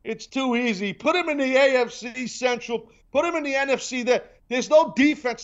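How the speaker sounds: mocking pundits. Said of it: "everybody's talking about".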